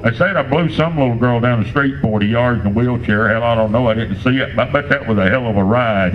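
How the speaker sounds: ordinary talk, nothing marked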